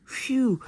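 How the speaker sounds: high, loud relieved exhale; relieved phew. Pretend. Hero made it through the door, a loud relieved phew. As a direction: blow out relief after a close call.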